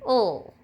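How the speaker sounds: "ul" said alone as a sound; isolated word-ending sound "ul"; final-syllable sound demo. Shown as a demonstration. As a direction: sound out "ul" as one short syllable. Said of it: "all have the sound".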